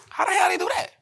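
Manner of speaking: high, incredulous squeak of disbelief; can't believe it, voice jumps up; astonished high-pitched question; amazed. Real, not acted. Amazed at AI music.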